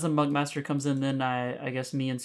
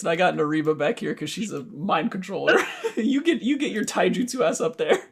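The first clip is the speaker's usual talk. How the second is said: high, raised voice breaking into laughter; playfully bicker, laughing through the order; laughing protest; 6 semitones higher, 6 dB louder than usual. Pretend. Laughing argument over party positions.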